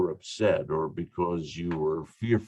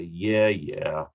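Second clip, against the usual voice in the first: offhand, grudging yes; half-interested, brush-off agreement; dismissive permission; 2 semitones lower than usual. Acted. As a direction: wave them off with a careless assent. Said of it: "they mean very different things".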